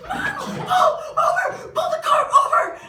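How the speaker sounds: panicked scream to pull over; high, loud frantic yell at mom; yelling out the window. Retold or imitated. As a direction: shriek out the car window to stop.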